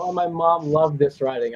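usual speaking voice

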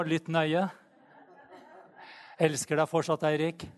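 joking through laughter; laughing. Real, not acted. Preacher teasing Eirik with a laugh. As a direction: say it while chuckling.

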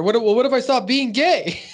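high, loud mock-pleading voice. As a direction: squeak it out like a desperate scoutmaster.

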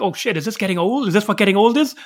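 imitating your own rising worry about ageing; worried self-questioning. Host recounting his fear of outgrowing games, anxious self-questioning.

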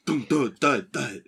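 imitated thuds. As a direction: mimic a run of bumps, evenly spaced. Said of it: "And you hear, like".

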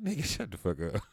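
mock comeback broken by laughter; laughing retort.

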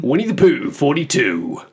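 high sing-song bingo call; bingo caller. Pretend.

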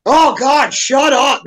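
groaning 'oh God, shut up'; exasperated blurt. Retold or imitated. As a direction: let it slip out, totally done with them. Tired blurt at someone talking.

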